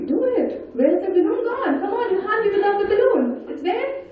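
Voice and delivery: high, eager urging of the group; excited coaxing. Real, not acted. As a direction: cheer everyone on to blow up the balloon. Urging audience to blow balloons.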